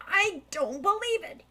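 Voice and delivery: high, astonished gasp of disbelief; jaw-dropped amazement; can't believe his ears; stunned disbelief. Acted. Thief stunned by revelation.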